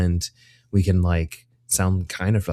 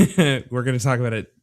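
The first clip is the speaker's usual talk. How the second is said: ordinary delivery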